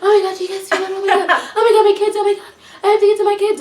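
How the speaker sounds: high-pitched imitation of panic; mock freak-out. Retelling the woman's worry for her kids, freaking out. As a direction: mimic a mother panicking over the kids. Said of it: "like, freaked out".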